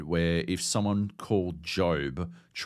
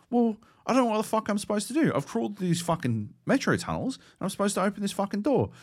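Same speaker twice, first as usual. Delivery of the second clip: frustrated rant; 12 semitones higher, faster than usual. Retold or imitated. Retelling his frustration with the game.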